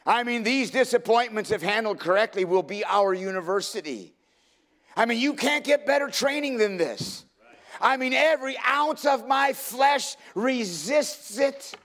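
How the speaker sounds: high, loud preacher at full force; fervent, straining cry to the congregation; impassioned preaching. Real, not acted. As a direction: preach it hard, voice pushed high.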